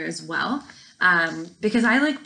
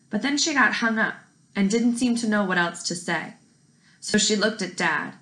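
ordinary delivery

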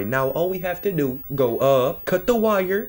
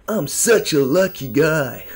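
usual speaking voice

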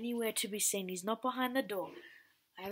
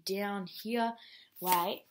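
ordinary delivery

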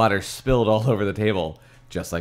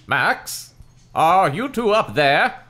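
slow, raised call from downstairs; loud, drawn-out, higher call; 10 semitones higher, 5 dB louder, slower than usual. A narrator's reading. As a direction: holler up the stairs as dad. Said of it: "I heard Dad calling from downstairs".

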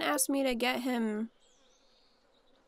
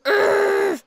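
frustrated groan; 11 semitones higher, 14 dB louder than usual. Real, not acted. Angry at lost quest.

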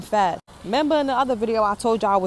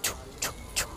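heartbeat sound; 12 dB quieter, faster than usual. Shown as a demonstration. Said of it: "your heart beating fast, like it's just pounding".

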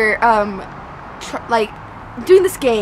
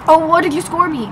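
ordinary talk

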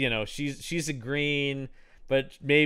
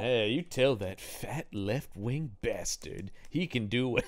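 Heston impression; 2 semitones lower, 6 dB quieter than usual. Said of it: "as we're doing this voice".

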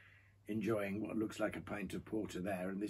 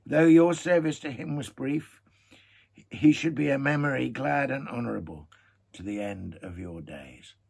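measured, storytelling read-aloud; story narration; 4 semitones higher, 10 dB louder than usual. A narrator's reading. Pipe smoker reading to listeners, storytelling voice. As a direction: read it like a fireside tale.

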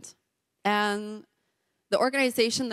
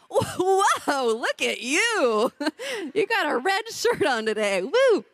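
mock amazed delight; 7 semitones higher, slower than usual. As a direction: gush like he's wearing a tuxedo. Teasing husband about red shirt.

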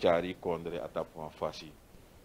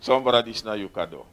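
loud, quicker, higher-pitched presentation call; 4 semitones higher, 9 dB louder, faster than usual. Real, not acted. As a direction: announce the gift loudly and briskly. Calls out the gift presentation again.

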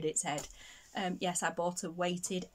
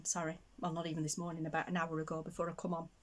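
usual speaking voice

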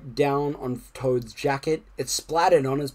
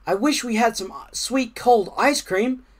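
higher-pitched character voice, wishing aloud; wistful Frog; 8 semitones higher than usual. A narrator's reading. Frog to Toad by the pond on a hot day, wistful. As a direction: voice a storybook frog longing for a treat.

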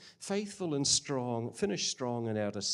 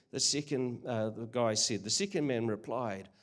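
ordinary talk, nothing marked

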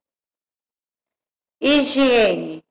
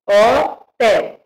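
ordinary talk

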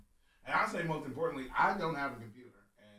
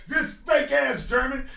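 teasing; 12 semitones higher, 10 dB louder, slower than usual. Real, not acted. Teasing the co-host loudly.

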